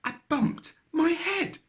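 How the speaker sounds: high, small, grumpy rabbit voice; a sulky little bunny complaining; cross little voice. A narrator's reading. Little rabbit grumbling up from the bottom of the well. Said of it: "answered the cross voice again".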